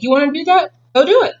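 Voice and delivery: grandfather daring. Retold or imitated. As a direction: dare the kid to go for it. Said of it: "grandfather was the type that was like".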